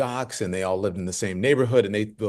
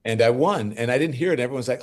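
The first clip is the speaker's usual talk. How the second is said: ordinary talk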